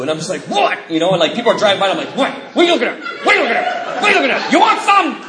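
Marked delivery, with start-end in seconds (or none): 0.4-0.8 high-pitched challenge to a stranger; confrontational. Retold or imitated. Acting out himself provoked by passersby. 2.1-5.2 high, hammed-up challenge building to a shout; mock belligerent yelling. Acts out yelling at passing drivers.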